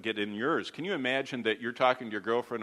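ordinary talk

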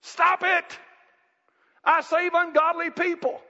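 God booms down to quit trying; God shouting. Retold or imitated. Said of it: "the God of heaven's looking down and saying".

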